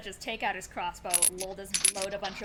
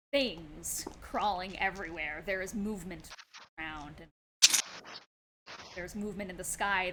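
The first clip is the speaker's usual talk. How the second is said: game master scene setting; 3 semitones lower, 5 dB quieter than usual. Acted. GM narrates scene.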